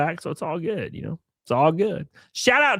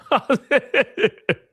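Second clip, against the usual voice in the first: high burst of laughter; 12 semitones higher, 4 dB louder than usual. Real, not acted. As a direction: cackle at the troll. Laughing at troll.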